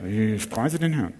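颤抖 Ich preise den Herrn with a shaky, wavering voice; trembling voice. Shown as a demonstration. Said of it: "Du kannst auch zittern dabei".

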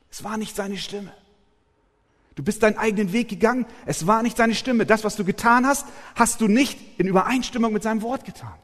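loud, high, fast rebuke from the pulpit; forceful preaching. Acted. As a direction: drive the warning home hard.

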